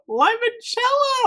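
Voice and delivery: very high, drawn-out delighted cry of Limoncello; excited squeal. Real, not acted. Reviewer crying out Limoncello to viewers in delight. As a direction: shriek Limoncello with joy. Bursts out naming the candle.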